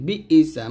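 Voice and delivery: "বি ইসা" with an extra ই before সা; common mispronunciation. Shown as a demonstration. Teacher showing a common mistake, added vowel. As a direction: glide from "বি" into a second vowel, "ইসা". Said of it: "আমরা অনেকেই বলি বি ইসা".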